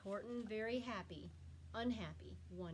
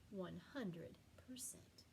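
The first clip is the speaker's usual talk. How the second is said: soft whispered ending; a whispered happy ending; 3 semitones lower, 8 dB quieter than usual. Shown as a demonstration. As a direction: breathe it out softly. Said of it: "whispered ending".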